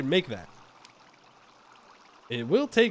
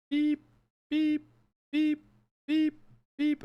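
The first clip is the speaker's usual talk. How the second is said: high imitated truck beeps; reversing beep; 13 semitones higher, slower than usual. Backing up the wagon, imitating a reversing beep. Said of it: "I'm not beeping very good".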